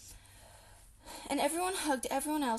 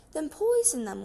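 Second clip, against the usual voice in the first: louder and higher, an irritated snap; impatient order; 5 semitones higher, 9 dB louder than usual. A narrator's reading. Mrs Bean orders Mabel from upstairs, irritated and loud. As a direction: bark it at the maid.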